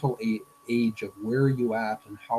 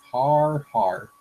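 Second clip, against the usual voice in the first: mock laugh; 2 semitones higher, 6 dB louder than usual. Cartoon character laughs at joke.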